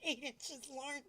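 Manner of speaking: ordinary talk, nothing marked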